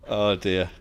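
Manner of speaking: ordinary talk, nothing marked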